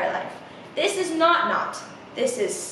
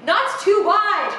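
ordinary delivery, nothing marked

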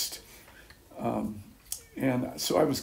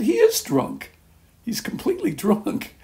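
much higher, laughing mid-word; giddy, amused discovery; laughing realization; 14 semitones higher, 6 dB louder than usual. Retold or imitated. Retold thought, laughing realization.

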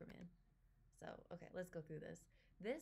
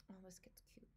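whisper; 9 dB quieter than usual. Real, not acted. Cooing at cute kid.

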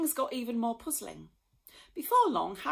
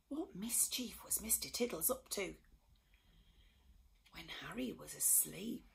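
hushed storyteller whisper; mysterious whisper; 9 dB quieter than usual. A narrator's reading. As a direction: whisper it like a secret. Narrator builds mystery.

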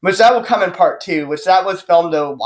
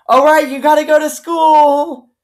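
mom voice; 10 semitones higher, 4 dB louder, slower than usual. Retold or imitated. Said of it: "You're probably one of those kids".

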